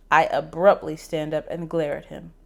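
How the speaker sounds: ordinary delivery, nothing marked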